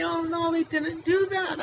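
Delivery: high, slow fake innocence; mock denial. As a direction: play it as a phony brush-off. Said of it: "he can just say".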